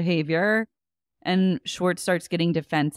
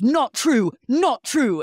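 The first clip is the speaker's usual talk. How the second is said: loud, high burst of denial; imitated heckle, pitched up and forceful; mock indignant shout; 6 semitones higher, 4 dB louder, slower than usual. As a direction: punch both denials out loud, imitating an outburst. Retelling James's shouted interjection.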